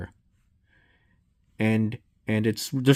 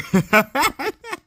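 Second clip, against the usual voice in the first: nervous high laugh. Real, not acted. Nervous laugh in horror game.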